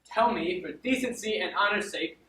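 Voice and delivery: indignant, building accusation; aggrieved demand. Acted. Wronged steward demanding an explanation from his lady, mounting indignation. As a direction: lay out the evidence with mounting outrage.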